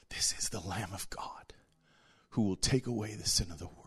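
whispered, hushed proclamation; awed whisper. Acted. Preacher reveals the Lamb of God in a whisper. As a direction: breathe it out as a sacred secret.

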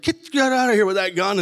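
high, urgent mock order; retold scolding order. Hotel owner ordering gunman away, mock scolding. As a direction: act out shooing someone off.

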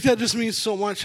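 high-pitched mock tearful voice; imitated tearful nurse. Nurse moved by patient's apology, imitated tearful voice. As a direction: imitate someone choked up with gratitude. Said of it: "And she started crying".

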